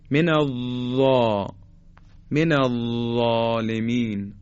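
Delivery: slow من الظالمین with ظ tongue tip on teeth; من الظالمین with a short dental ظ; zha demo. Shown as a demonstration. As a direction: slowly show ظ in الظالمین. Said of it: "به این شکل".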